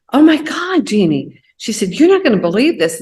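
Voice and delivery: high, loud, fast burst of amazement; excited retelling. Retold or imitated. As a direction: gush like a friend bursting with news. Friend calls with amazing news.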